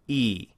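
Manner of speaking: long E vowel, tongue lifted at the back; vowel E demo. Shown as a demonstration. Teacher demonstrating the E vowel for students, held. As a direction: say E with a narrow space in the back of the mouth. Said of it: "So when you say E".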